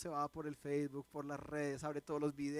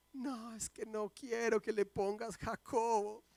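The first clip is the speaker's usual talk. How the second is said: put-on young daughter; 4 semitones higher, slower than usual. Daughter voiced crying.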